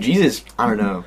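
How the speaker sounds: usual speaking voice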